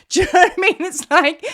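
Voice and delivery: laughing loud and high. Real, not acted. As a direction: burst out laughing as you ask. Laughing at own joke.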